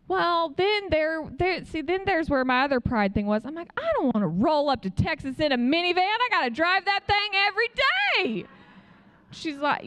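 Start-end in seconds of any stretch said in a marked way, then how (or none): 3.8-8.5 whiny protest. Retold or imitated. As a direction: pitch climbing to a high wail. Retold prideful protest about minivan.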